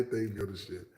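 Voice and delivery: ordinary delivery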